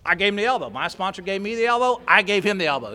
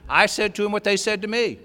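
ordinary delivery

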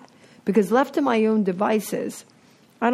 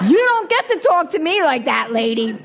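defiant retort; 7 semitones higher, 5 dB louder than usual. Retold or imitated. Retorting to angry party host.

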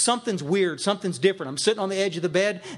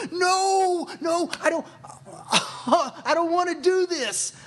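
high, drawn-out mock wail of refusal; hammed-up cry of dread; inner wail; 11 semitones higher, slower than usual. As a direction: whine in comic panic, pitched way up. Acts out inner panicked refusal.

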